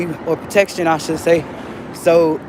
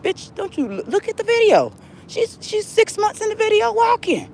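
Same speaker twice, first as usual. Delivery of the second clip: exasperated. Real, not acted. Angry retort to commenters.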